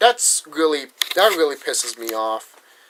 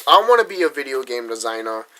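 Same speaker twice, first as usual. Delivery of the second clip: ordinary talk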